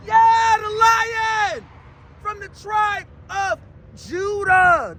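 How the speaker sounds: high, loud cry slowing into weighty phrases; triumphant pulpit proclamation; exultant preaching. Acted. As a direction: cry it out, then stretch each word. Preacher exults over scripture.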